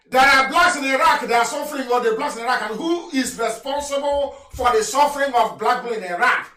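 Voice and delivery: high, loud denouncing sermon voice; fiery preacher condemning; preaching at full force. Real, not acted. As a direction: rail against them from the pulpit.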